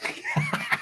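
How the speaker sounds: high shrieking laugh; burst of laughter. Real, not acted. Host cracking up at the eye patch, shrieking laugh. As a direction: let a squealing laugh burst out.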